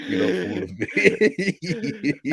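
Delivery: high, drawn-out laughing jibe; amused, stretched-out squeal of disbelief; laughing tease. Real, not acted. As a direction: laugh through it as you tease a friend. Teasing argument about accent, laughing.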